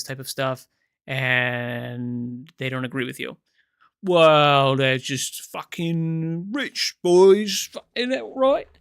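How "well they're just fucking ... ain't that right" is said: loud, drawn-out mock British accent; hammed-up British accent, rising at the end; put-on British accent. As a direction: lampoon him in a broad, loud British accent. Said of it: "the second I do the accent".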